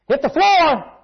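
loud, high urgent shout; shouted command. Retold or imitated. Dad shouting at his family to get down, loud. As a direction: yell it as danger hits. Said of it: "when he says".

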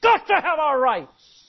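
hammed-up shouted protest chant; mock screaming. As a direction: belt it out like a protester. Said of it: "nearly screaming at the top of their lungs".

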